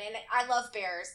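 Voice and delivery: normal talking voice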